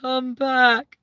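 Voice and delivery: high, long call; high pleading call back. Pretend. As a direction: call after them as they go. Calling after someone leaving.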